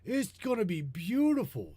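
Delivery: lightly voiced Trump gushing; mock Trump. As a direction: brag like Trump, fading after the first line. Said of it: "says Trump".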